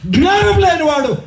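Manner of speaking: rhythmic, building sermon cry; full-force preaching. Real, not acted. Preacher says the Bible calls the unrepentant foolish, full-force preaching. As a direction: pile up the charges phrase by phrase.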